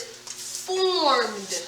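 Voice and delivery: formed stretched slowly, one long vowel; one-syllable demonstration. Shown as a demonstration. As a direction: draw out formed and land on D. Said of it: "it's all one syllable".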